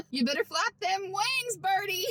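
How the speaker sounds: playful urging. Pretend. Vlogger egging on an imagined birdie in flight, playful high holler. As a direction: holler it up high at a make-believe bird.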